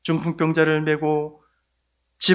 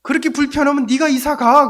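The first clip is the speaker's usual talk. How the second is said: higher, louder, scornful jab; rude retort; 8 semitones higher, 5 dB louder than usual. Retold or imitated. Rude neighbour telling the pastor to move out, scornful. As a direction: sneer it down at him. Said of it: "뭐라 그러냐면은".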